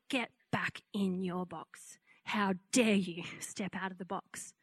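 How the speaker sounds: slow, menacing whisper; sinister enemy voice. Retold or imitated. The enemy taunting believers to return to their box, menacing whisper. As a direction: hiss it like a devil in her ear.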